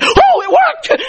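high squealed shout of amazement; astonished outburst. Retold or imitated. Preacher reenacting the moment the bone popped, astonished squeal. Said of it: "I went".

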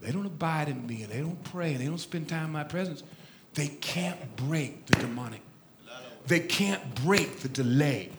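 low, hushed fatherly voice; quiet, deep fatherly confidence to a son; God as father. Retold or imitated. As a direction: drop down soft and deep, confiding. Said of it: "His daddy goes".

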